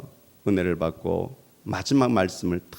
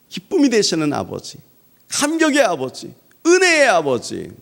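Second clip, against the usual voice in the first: 1 rising fervent cry; 12 semitones higher, 8 dB louder than usual. Acted. Building exclamations.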